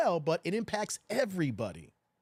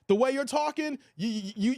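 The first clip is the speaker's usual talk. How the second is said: normal talking voice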